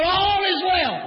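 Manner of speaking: high, drawn-out preaching cry; exultant pulpit cry of the woman's answer; cried-out faith. Acted. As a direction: stretch it out and call it high.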